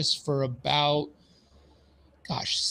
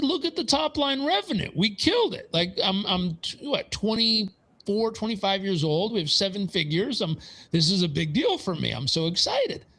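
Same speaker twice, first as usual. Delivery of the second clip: put-on higher, eager young entrepreneur insisting; proud protest; 6 semitones higher than usual. Young owner arguing his revenue to the accountant, higher and proud. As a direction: push back proudly, sure you killed it. Defending his best year.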